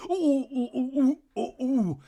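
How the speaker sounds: high mocking ooh moans; teasing ecstatic ooh-ing; mock pleasure moans. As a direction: moan up high in mockery. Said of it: "He's like".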